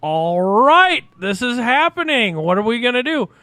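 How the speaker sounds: high, loud thrilled outburst; retold kid excitement. Kid as Hulk Hogan walks over, retold excitement. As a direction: reenact a starstruck kid bracing himself. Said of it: "I am just like".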